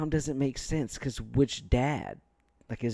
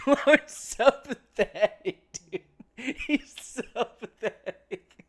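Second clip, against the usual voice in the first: laughing; 8 semitones higher, slower than usual. Real, not acted. Streamer laughing at call.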